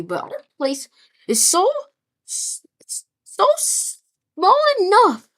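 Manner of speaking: excited boast. Acted. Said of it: "They're like".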